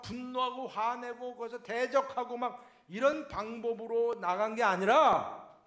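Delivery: preaching. Acted. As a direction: drive the point home from the pulpit. Preaching at full force.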